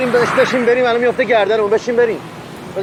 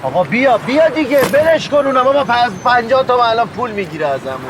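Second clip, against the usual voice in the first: urgent urging; 5 semitones higher than usual. Acted. Urging friend to flee.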